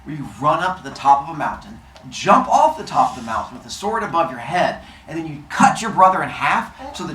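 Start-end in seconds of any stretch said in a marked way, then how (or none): none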